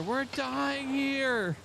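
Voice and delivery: slow high drawn-out wail; dramatic wail. Acted. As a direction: stretch it out in mock despair. Crisis in game.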